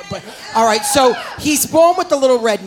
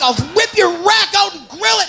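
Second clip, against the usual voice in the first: high mock-furious threat; pissed-off yell, acted; 7 semitones higher than usual. Comic plays the angry drummer boy at his animals, high yell. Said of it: "He's so pissed".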